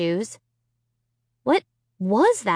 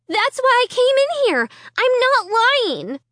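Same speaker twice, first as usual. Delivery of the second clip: high, desperate insistence; pleading; 4 dB louder than usual. A narrator's reading. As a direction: beg them to believe. Girl defending herself.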